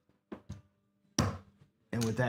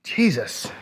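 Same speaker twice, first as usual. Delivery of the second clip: normal talking voice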